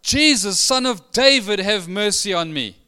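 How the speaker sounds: loud, high cry, easing at the end. Acted. Excited blind beggar calling to Jesus for mercy, crying out. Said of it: "he cries out".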